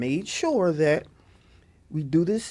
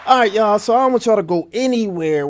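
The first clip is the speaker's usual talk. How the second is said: shouting; 4 semitones higher, 7 dB louder than usual. Real, not acted. Host calling out to listeners.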